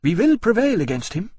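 queen fiercely vowing to fight on. A narrator's reading. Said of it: "she said fiercely".

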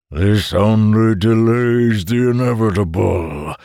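slow, drawn-out monster voice; bellowing monster. A narrator's reading. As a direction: bellow it slowly, as an unstoppable beast. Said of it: "the monster bellowed".

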